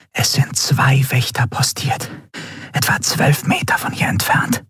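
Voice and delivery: hushed, whispered scouting report. A narrator's reading. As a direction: whisper it so the guards can't hear. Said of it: "Sie senkte die Stimme zu einem Flüstern".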